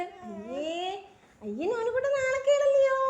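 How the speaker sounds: high, loud mock shame; playful teasing. Mother to crying baby, loud playful teasing. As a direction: tease him out of crying.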